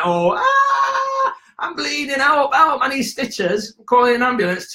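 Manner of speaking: very high, whiny cry for help; mock childish panic. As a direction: ham up a five-year-old's panic over a cut. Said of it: "I'm gonna cry like a five-year-old".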